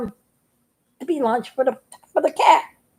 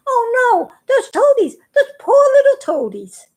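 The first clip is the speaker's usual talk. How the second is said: high, loud worried cry of a little frog; panicking; 10 semitones higher, 4 dB louder than usual. A narrator's reading. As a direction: fret for the toadies in a high voice. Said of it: "he was, like, panicking".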